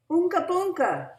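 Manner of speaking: put-on high, loud silly baby talk; big brother voice. A narrator's reading. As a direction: coo nonsense at the baby. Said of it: "he said to Louise".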